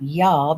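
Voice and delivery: "y'all" as one drawn-out Southern syllable. Acted. Lisa trying out the corrected word, a Southern "y'all". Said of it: "It's y'all".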